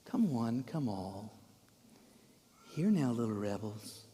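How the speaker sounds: soft, low coaxing call; mock gentle. Shown as a demonstration. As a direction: gather them in softly, as if to little children. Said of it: "Do you think it's like that?".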